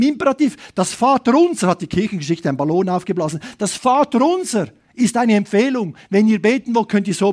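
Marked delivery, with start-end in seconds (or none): none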